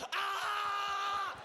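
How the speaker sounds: high mock tantrum scream; hammed-up kid screaming for his tablet; toddler scream. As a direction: scream like a child detoxing. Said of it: "He's like".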